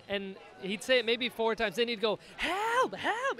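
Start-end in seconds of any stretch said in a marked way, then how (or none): 2.4-3.4 very high parrot squawk; high-pitched mock scream; imitated screaming parrot. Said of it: "and just start screaming".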